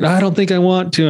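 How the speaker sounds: put-on a touch of a scared child; teary little girl. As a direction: daughter on the range, lightly teary. Daughter refusing, voiced high.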